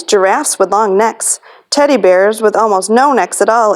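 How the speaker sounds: ordinary delivery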